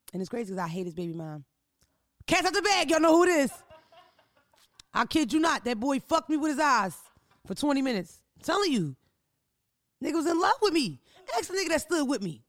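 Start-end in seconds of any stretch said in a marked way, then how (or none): 2.3-12.4 loud and high, bursting with the gossip; can't hold the secret in any longer; excited gossip. Real, not acted. Podcast host excitedly telling listeners about a rapper, loud and high.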